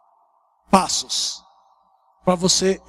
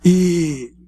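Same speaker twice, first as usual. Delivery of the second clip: usual speaking voice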